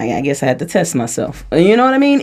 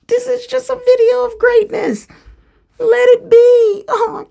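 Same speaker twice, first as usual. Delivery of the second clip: melodramatic diva; 4 dB louder than usual. Pretend. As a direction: overplay it like a weepy diva. Mock dramatic self-praise.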